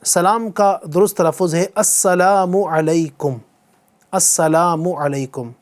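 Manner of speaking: slow, teacherly "السلام علیکم" with every syllable clear: as-sa-laa-mu a-lai-kum; careful correct pronunciation. Shown as a demonstration. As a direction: say "السلام علیکم" slowly for students to repeat, with the full "as-salaamu". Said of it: "پہلے میں کہتا ہوں، آپ بھی میرے ساتھ ساتھ دہرائیے". (آہستہ اور صاف "السلام علیکم"، ہر حرف واضح)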